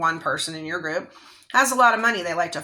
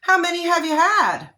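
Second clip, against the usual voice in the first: mocking fake concern; 9 semitones higher than usual. The girl who pushed her mocks her, fake concerned then taunting. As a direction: pretend worry that turns to a jeer. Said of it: "she's saying".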